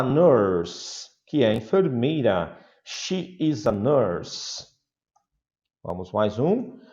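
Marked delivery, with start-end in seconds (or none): 2.8-4.7 slow model answer. Shown as a demonstration. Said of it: "slow model answer".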